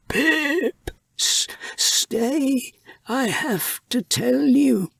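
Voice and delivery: put-on an old rat struggling to hold the boy back; dying old rat. A narrator's reading.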